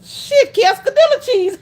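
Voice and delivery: high, loud exasperated retort; mocking comeback. Server answering a silly cheese question, loudly mocking. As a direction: throw the mangled word back, loud and high.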